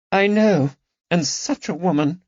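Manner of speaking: high, wounded aristocratic voice; grieving the loss of a lover to treachery; sad lady's lament. Acted. Lady Caroline lamenting to her friends, high and sad.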